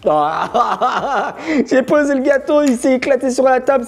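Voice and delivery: high, laughing exasperation. Real, not acted. Laughs at broken cookie.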